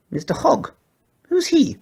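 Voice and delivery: very high questioning pug voice; a pug hearing a strange name; high pug voice, puzzled; puzzled squeaky pug. A narrator's reading. Porky's voice, puzzled.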